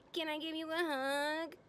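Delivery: put-on high, girlish little plea; mock pleading. Megan voiced asking mom for hug.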